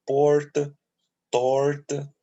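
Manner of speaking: Porta and torta with a curled, pulled-back R; paulista R. Shown as a demonstration. Teacher showing students the pulled R in Porta, torta. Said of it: "Esse R".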